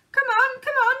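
very high, loud urgent call; loud shout. A narrator's reading. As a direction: shout through the door to wake him. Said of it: "he cried".

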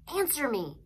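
mildly acted impatient order; insistent demand. A narrator's reading. Brother demands an answer, acted.

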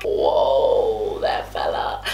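very low, drawn-out mock caution; mock warning. She reins in the bragging boyfriend, mock warning. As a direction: drop the voice right down to check him.

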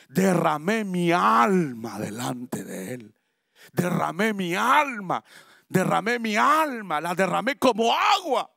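slow, crying prayer; tearful pleading. Acted. Ana pours out her soul before God, tearful. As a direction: pour your soul out weeping.

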